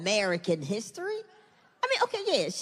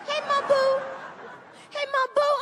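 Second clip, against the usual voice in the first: put-on little girl calling; 12 semitones higher than usual. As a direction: call out like a cheery little girl. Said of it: "Now it's just Mom Boo".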